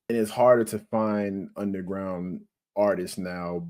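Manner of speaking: normal talking voice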